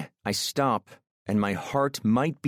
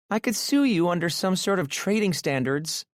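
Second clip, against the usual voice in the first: much higher, playful worried woman; teasing woman's voice; 10 semitones higher than usual. A narrator's reading. Aurora jokes with Maxim about being bad in bed, high and playful. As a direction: tease him in a high voice.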